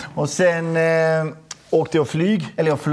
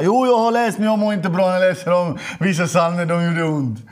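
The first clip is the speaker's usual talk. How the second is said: pleading answer; 4 semitones higher, 3 dB louder than usual. Retold or imitated. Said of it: "Han bara".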